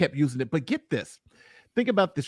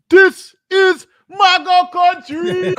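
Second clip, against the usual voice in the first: slow, high, loud proclamation; mock shout; 12 semitones higher, 7 dB louder, much slower than usual. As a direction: belt it out slowly. Imitating judge, shouted quote.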